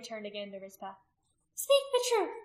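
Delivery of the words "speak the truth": very high, loud threat; threatening demand. A narrator's reading. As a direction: shriek a threat at the accused. Priestess threatens the accused.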